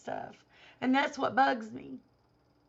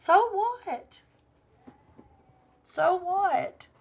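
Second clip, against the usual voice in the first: scoffing So what, pitched up; dismissive; 7 semitones higher, much slower than usual. Real, not acted. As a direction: brush it off with scorn. Dismissive retort to critic.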